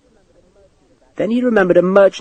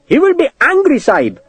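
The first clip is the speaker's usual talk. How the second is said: much higher clerk voice in alarm; frightened clerk; 14 semitones higher than usual. Acted. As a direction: warn the officer in a panic.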